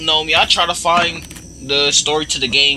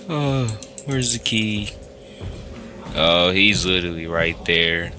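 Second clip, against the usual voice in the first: usual speaking voice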